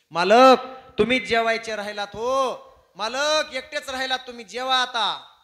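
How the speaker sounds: high, loud summons; calling out. Acted. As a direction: call the master in to eat from across the yard. Calling the host to eat.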